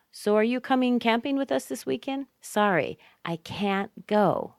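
teacher playing both sides; acted model dialogue. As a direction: act out the invitation, then stress "can't" and "go".

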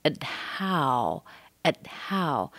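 "at how" with schwa and unreleased t; held T. Shown as a demonstration. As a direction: keep the t of "at" inside, then breathe the h. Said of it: "Schwa held T and then the H".

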